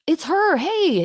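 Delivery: high, loud cheery hey; excited greeting. Retold or imitated. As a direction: call out like a crowd spotting a friend. Fairies greeting her, retold.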